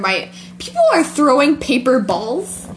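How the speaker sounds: normal talking voice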